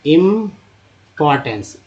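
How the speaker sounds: इम्पॉर्टेंस said slowly, part by part; slow teacher's read of इम्पॉर्टेंस; slow sounding out. Shown as a demonstration. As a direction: draw out इम्पॉर्टेंस for the class to copy. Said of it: "एन से टी का लगा तो टेंस हो गया".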